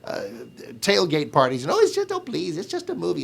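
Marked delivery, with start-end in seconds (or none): 1.7-3.2 mocking fan. Mocking fans dismissing critics.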